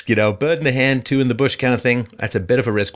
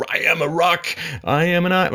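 high-pitched mock proclamation; mock defiance; 9 semitones higher than usual. Spouse refusing to be influenced in any way, mock defiance. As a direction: declare it grandly in a higher mocking voice.

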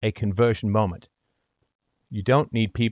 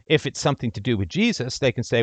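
ordinary talk

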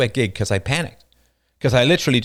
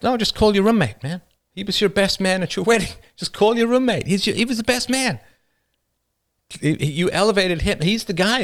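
higher, laughing sarcastic send-off; mocking brush-off; 7 semitones higher than usual. Speaker retelling his mocking reply to Bob, higher and laughing. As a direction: rub it in, tell him to call the roommate. Mocking friend who dumped him.

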